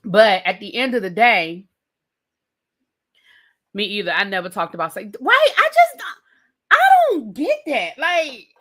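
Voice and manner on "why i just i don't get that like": high, loud incredulous cry; baffled outburst. Real, not acted. Baffled by people talking like that in front of parents. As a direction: throw up your hands in disbelief.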